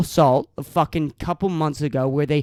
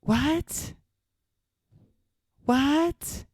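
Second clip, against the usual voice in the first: mock disbelief; 6 semitones higher, 5 dB quieter than usual. Real, not acted. Incredulous at specific weeks.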